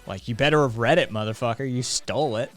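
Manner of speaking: normal talking voice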